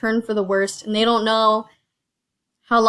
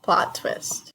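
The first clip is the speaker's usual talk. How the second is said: whispered, far softer echo; 7 dB quieter than usual. Real, not acted. Host quietly whispering back the guest's game idea. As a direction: breathe the words back to yourself.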